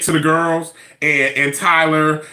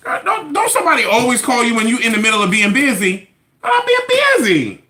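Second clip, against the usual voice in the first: exasperated whine; 6 semitones higher than usual. Real, not acted. Exasperated complaint.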